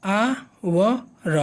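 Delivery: आ व र split into slow separate sounds; syllables pulled apart for students; sounded out syllable by syllable. Shown as a demonstration. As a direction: spell out आवर sound by sound, very slowly. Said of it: "आ से".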